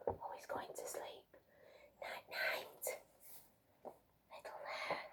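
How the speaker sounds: breathy bedtime whisper, barely there; soft night-night whisper over a sleeping child. Acted. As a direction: hush it so the little one can sleep. Bedtime, hare falls asleep.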